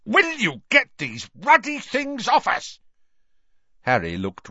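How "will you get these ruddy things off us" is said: high, forceful yell; outraged shout. A narrator's reading. Uncle Vernon protesting about the glasses, outraged shout. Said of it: "Uncle Vernon shouted".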